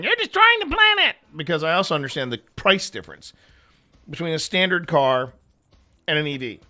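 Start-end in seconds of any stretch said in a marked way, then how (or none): 0.0-1.1 much higher, shrill-free mock preach; mock accusation. Owner mocking a preachy EV driver's accusation, high. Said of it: "I'm not going on saying".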